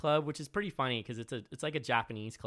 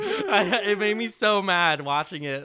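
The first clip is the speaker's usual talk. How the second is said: laughing; 6 semitones higher, 8 dB louder than usual. Real, not acted. Laughing while telling.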